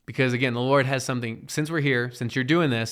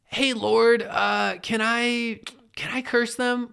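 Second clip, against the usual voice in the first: much higher, timid mock asking; sheepish much higher voice; 9 semitones higher, much slower than usual. Believer sheepishly asking the Lord a second time, much higher mock voice. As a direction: squeak it out like a guilty kid asking again. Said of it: "And then you're like".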